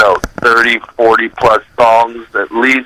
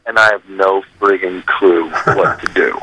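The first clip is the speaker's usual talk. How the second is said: whisper; 6 semitones lower than usual. Real, not acted. Confessing not knowing what to do.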